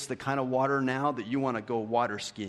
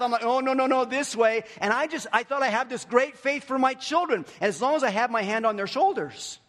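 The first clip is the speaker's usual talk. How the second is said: higher, louder, driving sermon voice; preaching at full force; 11 semitones higher, 6 dB louder than usual. Acted. Pastor retelling Luke to the congregation, preaching full force. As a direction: preach it out with rising fire. Fervent sermon about his children.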